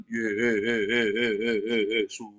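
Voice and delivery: very slow, wobbling prayer call, teasingly imitated; Pak Murji praying. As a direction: stretch the name out in an exaggerated quavering prayer. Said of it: "kalau enggak itu Pak Murji doanya". (meniru doa yang mendayu, panjang dan bergetar)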